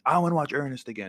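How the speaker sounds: put-on high, quick childish demand; bickering kid. Kid fighting his sister over the television, mock-childish demand. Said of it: "would fight so much about the television".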